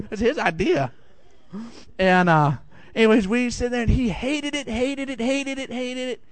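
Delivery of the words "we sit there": ordinary talk, nothing marked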